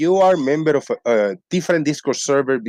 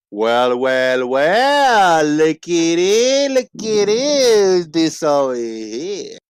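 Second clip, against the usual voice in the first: slow drawn-out voice; 4 semitones higher, much slower than usual. Acted. Frog character speaks.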